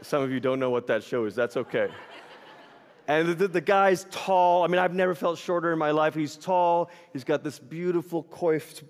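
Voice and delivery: usual speaking voice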